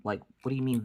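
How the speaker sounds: ordinary talk, nothing marked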